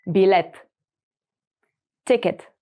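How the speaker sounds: normal talking voice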